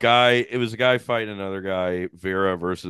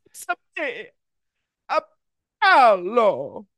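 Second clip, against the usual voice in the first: high, hammed-up Italian accent; Jared Leto; 13 semitones higher, 6 dB louder than usual. Mocking the bad movie accents, over-the-top high Italian accent. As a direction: sing out a cartoonish Italian accent, drawn out at the end. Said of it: "We're doing Italian accents".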